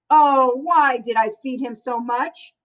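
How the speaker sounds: ordinary delivery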